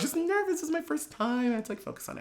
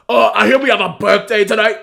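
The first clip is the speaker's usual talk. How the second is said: louder, higher drag queen announcer voice; bad Candy Muse impression; 7 semitones higher, 10 dB louder than usual. Imitating the drag queen greeting the birthday crowd, louder and higher. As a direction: ham up a drag host on the mic. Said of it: "I do a bad Candy Muse".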